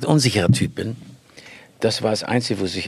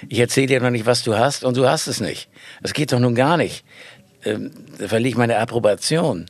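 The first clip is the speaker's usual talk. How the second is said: ordinary talk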